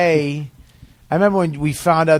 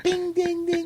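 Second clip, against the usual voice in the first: high, soft bing ding sound effect; coin jingle imitation; 6 dB quieter, slower than usual. Imitating change rattling in his pocket, high and soft. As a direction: mimic coins jingling in a pocket. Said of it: "You said".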